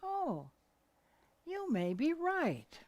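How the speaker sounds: high, slow, sweet concession; drawn-out light agreement; demonstrated agreeable reply. Shown as a demonstration. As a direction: raise the pitch and give way gently. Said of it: "and you say".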